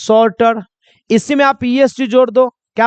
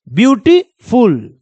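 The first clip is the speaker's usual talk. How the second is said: Beautiful slowly broken into beauty and फुल; split into beats; slower than usual. Shown as a demonstration. Teacher showing students where the sound breaks, beautiful split slowly in two. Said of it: "बोलोगे तो sound टूट जाएगा".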